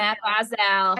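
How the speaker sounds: put-on slow Matt Barzal in a Boston accent. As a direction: say the name like a Bostonian. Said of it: "thick Boston accent".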